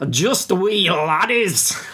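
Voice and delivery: slow, high put-on Scottish accent; drawn-out hammed-up Scottish brogue; bad Scottish accent. Co-host tries the line, bad Scottish accent. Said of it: "you can have multiple shots at it".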